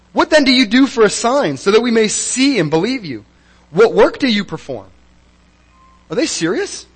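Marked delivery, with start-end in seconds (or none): 0.1-4.9 higher, louder, mocking the crowd's demand; petty demand. Shown as a demonstration. Preacher mimicking the crowd demanding a sign from Jesus, higher, louder, mocking. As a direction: read it as a petty, pushy challenge. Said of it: "How petty".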